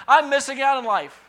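high, loud whining self-pity; mock whine. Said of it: "drop it in our thinking".

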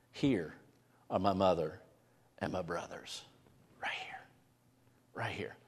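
hushed, breathy whisper of Jesus; Jesus whispering to those seated round him; quiet whisper. Retold or imitated. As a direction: lean in and whisper it to the circle. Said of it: "quietly looking in all their eyes".

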